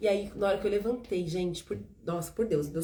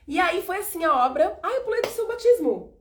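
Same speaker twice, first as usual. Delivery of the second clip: laughing; 10 semitones higher, 5 dB louder than usual. Real, not acted. Laughs at losing the thread.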